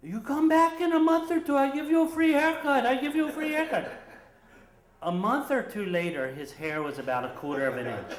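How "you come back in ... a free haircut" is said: high, loud broken-English barber. Retold or imitated. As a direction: placate him in broken English. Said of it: "He spoke broken English".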